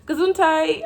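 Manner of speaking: high, loud bless-you in German; called-out blessing. Retold or imitated. Answering somebody's sneeze, called-out blessing. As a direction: sing it out to a sneezer.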